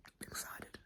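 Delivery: breathy hushed I'm excited; whispered excitement. Real, not acted. To viewers, hushed excitement whisper. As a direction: whisper it as a secret thrill. Whispered then repeated aloud.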